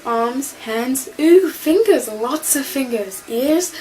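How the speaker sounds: excited, choppy self-inventory in bursts; Eleven. Acted. Fan quotes the Doctor's first words, excited role-play. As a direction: play a newly regenerated man checking his body parts. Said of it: "it's Eleven".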